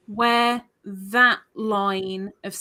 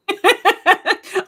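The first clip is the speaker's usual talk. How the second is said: laughing; 10 semitones higher, 8 dB louder than usual. Real, not acted. Laughs at her own accent.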